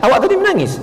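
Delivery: curious questioner. Retold or imitated. Friend at prayer asks another why, high curious voice. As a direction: play a curious friend asking after prayer. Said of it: "mereka pun saling bertanya".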